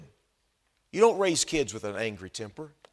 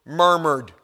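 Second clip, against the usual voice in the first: "murmured" leaned on, louder and drawn out; stress on murmured; 3 semitones higher, 9 dB louder than usual. Shown as a demonstration. Preacher underlines "murmured" to the congregation. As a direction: hit "murmured" hard. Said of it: "look at the word".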